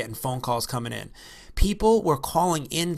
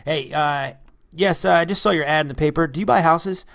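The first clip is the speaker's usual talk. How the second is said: loud, higher eager caller; louder, higher caller on the phone; man caller voice; 3 semitones higher, 7 dB louder than usual. Pretend. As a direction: play a man calling about his house, loud. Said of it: "I'm gonna be a man".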